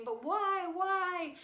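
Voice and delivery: high, loud mock whine; questioning child. Daughter keeps asking mom why, mock high wail. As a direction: imitate a child wailing a question. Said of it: "She kept saying".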